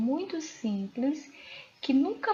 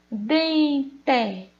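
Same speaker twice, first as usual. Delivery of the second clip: "Dente" louder, split into DEN and TE; syllable-by-syllable demonstration; 3 semitones higher, 5 dB louder than usual. Shown as a demonstration. Teacher asking pupils for the tonic syllable of "Dente", sounded out in parts. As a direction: sound out "Dente" in two deliberate beats. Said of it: "Qual é a sílaba tônica dessa palavra?".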